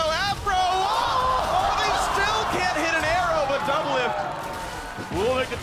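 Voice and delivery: loud, high hype call; excited. Real, not acted. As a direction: shout the action, rising.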